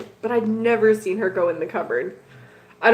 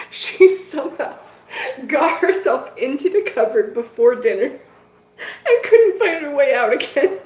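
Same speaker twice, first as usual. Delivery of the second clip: higher and louder, words broken by laughter; can't stop giggling at the cat; laughing through it; 5 semitones higher, 4 dB louder than usual. Real, not acted.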